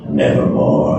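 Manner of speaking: low, dark raven voice dropping into one word; ominous low raven croak; raven's croak. Acted. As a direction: let the bird answer from deep down. Raven answers in poem.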